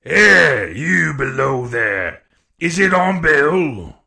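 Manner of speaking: loud, slow hail to those below; cried demand. A narrator's reading. Blind man to searcher downstairs, loud slow cry. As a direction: hail them loudly, stretching the words. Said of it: "cried the blind man again".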